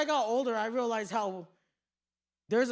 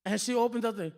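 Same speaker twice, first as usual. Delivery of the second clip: ordinary delivery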